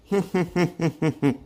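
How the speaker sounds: a short jeering laugh at a friend's expense; mocking laugh. Chintu laughing at his drunk joke, mocking laugh.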